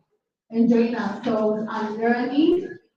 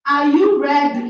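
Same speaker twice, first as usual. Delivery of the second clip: loud rally to the crowd; hype call; 7 dB louder than usual. Real, not acted. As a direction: fire up the class. Teacher hyping up the students.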